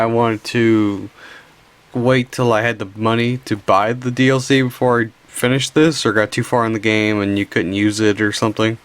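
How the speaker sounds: ordinary delivery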